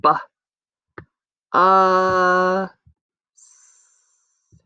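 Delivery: "B. Uh, s." as separate sounds, the vowel held long. Shown as a demonstration. Teacher sounding out bus for children, sound by sound. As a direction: segment "B. Uh, s." with clear gaps between sounds. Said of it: "press the chips up to the sounds we hear".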